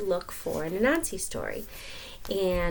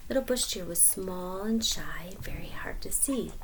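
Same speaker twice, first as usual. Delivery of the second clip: whisper; 3 semitones lower, 7 dB quieter than usual. A narrator's reading. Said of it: "describing shy little deer".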